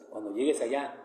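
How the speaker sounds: a hint of his late mother's soft voice; mother's tender advice. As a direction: pass on a mother's gentle warning, hushed. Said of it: "Así me lo dijo mi madre".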